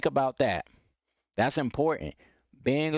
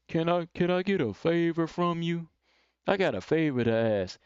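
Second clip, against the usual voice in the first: mock timid favor request in a put-on voice; weak, passive asking; 4 semitones higher than usual. Shown as a demonstration. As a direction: hesitantly beat around the bush. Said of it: "You coming off so weak".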